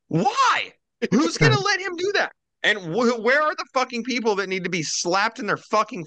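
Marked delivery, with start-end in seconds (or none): 0.1-2.3 squeaky, much higher exasperated why; high incredulous mock outrage; outraged disbelief. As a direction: throw up your hands in disbelief. Retold outraged question, very high.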